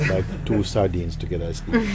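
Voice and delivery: ordinary talk, nothing marked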